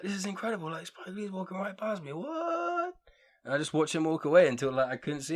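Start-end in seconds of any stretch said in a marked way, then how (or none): 0.0-2.1 high, hushed disbelief; quietly starstruck, voice lifted; awestruck. Retold or imitated. Retelling his awestruck inner reaction. 2.1-2.9 very high, drawn-out squeak of amazement; mock-stunned falsetto; stunned disbelief. Retelling his stunned reaction.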